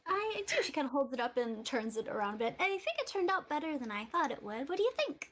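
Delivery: put-on a pleased young crafter showing off; Eliza voice. GM voicing Eliza.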